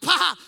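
sudden loud, high blurt; burst of laughter. Retold or imitated. Cannot laugh at funerals, blurted laugh. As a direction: pop out a stifled laugh. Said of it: "you cannot laugh at funerals".

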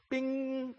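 high sung ding of a doorbell; doorbell imitation. Preacher mimics neighbour kids ringing doorbell, high chime.

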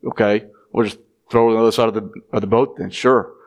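lightly voiced sarcastic mutter, fast; a quick grudging, sarcastic okay; snarky. Tired fishermen mocking the stranger's advice, mild snark. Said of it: "snarkily they're thinking".

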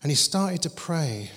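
ordinary delivery

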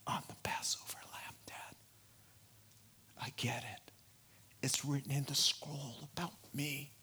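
softly whispered, reverent; hushed whisper. Retold or imitated. Twelve-year-old Jesus quietly telling his parents, whispered. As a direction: breathe it as a quiet revelation. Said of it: "perfect little respectful twelve-year-old's voice".

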